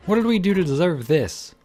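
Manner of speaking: high, loud anguished screaming; desperate mindless cry. Acted. June screaming at the unseen captors, desperate mindless cry. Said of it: "She screamed again, a desperate, mindless cry".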